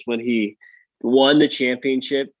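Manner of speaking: usual speaking voice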